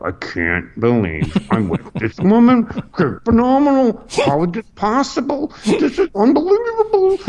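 strained, very high squeal of disbelief; hammed-up wonder climbing into a squeak; mock awestruck. Comic gazing at his date, overdone high-pitched awe. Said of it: "I'd just be staring at her going".

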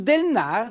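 "del nar" with the n sounded at the start of the word; dialect n-initial demo. Shown as a demonstration. Host teaching viewers a dialect word, clearly sounded. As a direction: say "del nar" in Riva dialect, opening straight on the n. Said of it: "in dialetto si può partire con n".

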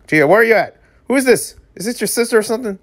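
high-pitched, baffled questioning; mock bewildered. Reader calling for missing Gia on seeing a stranger, mock bewildered. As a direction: call out high, as if searching.

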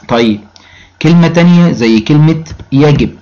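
ordinary delivery

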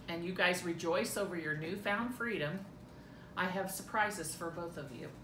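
ordinary talk

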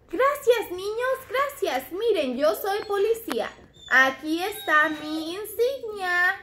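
proud little puppet cop announcing himself; police puppet voice. Pretend. Toy policeman showing his badge to the children, high puppet voice.

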